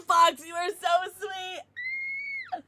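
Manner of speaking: squealed high, slow thanks; overwhelmed gushing thank-you. Real, not acted.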